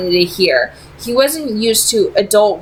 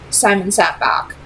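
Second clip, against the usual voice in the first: usual speaking voice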